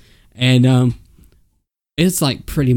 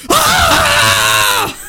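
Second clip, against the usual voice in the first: group shout; 6 dB louder than usual. Retold or imitated. Campers reacting to a burn.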